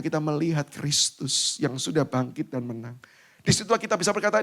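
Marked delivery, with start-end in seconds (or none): 3.5-4.4 loud, high preaching voice. Acted. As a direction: preach it out over the crowd. Preaching at full force. (khotbah lantang dan tinggi)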